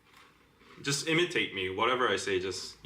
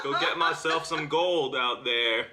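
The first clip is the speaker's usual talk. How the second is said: put-on cowboy accent. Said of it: "This is a real original cowboy accent".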